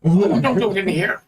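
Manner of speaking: high, dismayed objection; fussy library dweller. Acted. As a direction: plead with them to spare the room. Library dweller objects to sword test.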